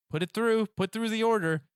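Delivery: exasperated surrender. Retold or imitated. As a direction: give in like an exasperated customer. Retold giving in on the order.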